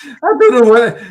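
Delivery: usual speaking voice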